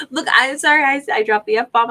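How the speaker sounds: breaking into a high laugh while apologizing; laughing apology. Real, not acted. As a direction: laugh it off, pitch jumping up. Laughs at her own swearing.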